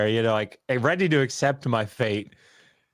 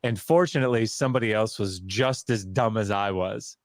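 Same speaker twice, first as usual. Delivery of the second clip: ordinary delivery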